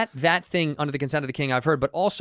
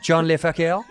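slow, louder mock-French name; mock French accent; 3 semitones higher, 5 dB louder than usual. Joking about an old French-sounding name, mock French accent.

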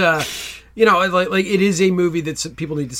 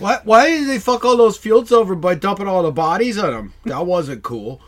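put-on slurred drunk rant; lightly played drunk caller; drunk dialing; 4 semitones higher, 6 dB louder than usual. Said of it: "drunk voicemails".

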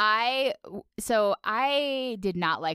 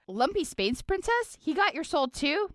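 put-on much higher, surprised young hero; surprised Finn; 10 semitones higher than usual. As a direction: jump way up in pitch, startled. Finn voiced shouting surprised.

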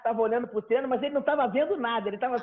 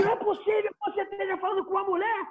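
higher, scolding outrage; indignant scolding; 7 semitones higher than usual. Retold or imitated. Friend berating the guy for how he talks, higher scolding. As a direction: lecture him like a brute. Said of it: "ele explodiu".

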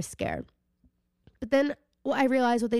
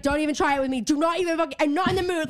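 higher, rising irritated warning; mild mock yell; retold angry warning; 5 semitones higher, faster than usual. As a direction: warn off everyone in the way. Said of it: "screaming at everyone".

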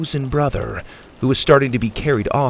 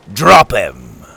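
much louder barked command; harsh order; 4 semitones lower, 8 dB louder than usual. A narrator's reading. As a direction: order the crew to drop him. Captain orders crew.

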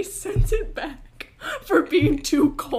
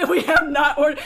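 loud, higher protest through laughter; mock exasperation; 4 semitones higher, 8 dB louder than usual. Starving daughter while waitress waits, loud comic exasperation. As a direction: hit it hard, half laughing. Acts out frustration at delay.

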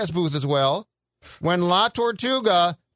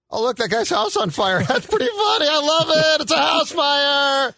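laughing, hammed-up shout of delight; mock laughing glee; 8 semitones higher, 4 dB louder than usual. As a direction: act out a gleeful fool cackling at a disaster. Mocking fans who laugh at fires.

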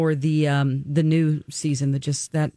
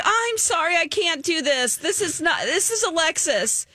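high, flustered apology, imitating Alexis; mock panic, voice pushed way up; 12 semitones higher than usual. Cohost imitating Alexis cracking during the prank, high and flustered. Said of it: "She was like".

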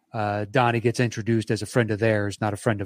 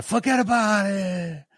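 mobster forget about it; 8 semitones higher than usual. Shown as a demonstration. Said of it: "Sometimes it just means fucking forget about it".

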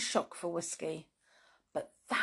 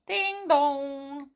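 doorbell ding dong; 8 semitones higher, 3 dB louder than usual. A narrator's reading. Doorbell rung in story.

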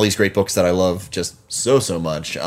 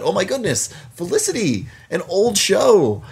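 incredulous; 10 semitones higher than usual. Real, not acted. Disbelief at old show.

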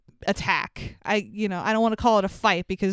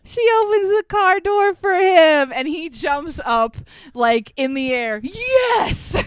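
excited cheer; 7 semitones higher, 4 dB louder than usual. Real, not acted. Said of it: "excited recap of the happy moment".

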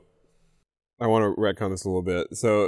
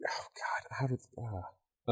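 whisper; 18 dB quieter, slower than usual. Real, not acted. Muttering to self.

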